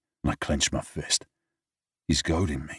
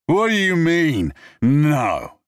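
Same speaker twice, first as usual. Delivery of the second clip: high, loud, indignant cry; drunken outrage; 12 semitones higher, 7 dB louder, much slower than usual. Acted. Drunk man protests James's refusal, loud and high. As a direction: bellow it drunk and offended.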